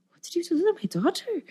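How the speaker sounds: high-pitched, amazed mock outcry; ecstatic mother. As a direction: imitate a mother overjoyed and astonished. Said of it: "she was just ecstatic".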